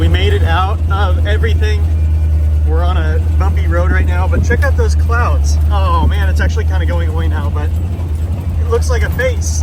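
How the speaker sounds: talking loudly over road noise. Real, not acted. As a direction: speak up over the rattling car.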